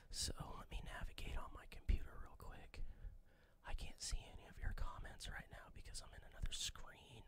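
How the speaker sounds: soft unvoiced aside; whispering. Real, not acted. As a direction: whisper it to yourself while working. Aside while navigating the computer.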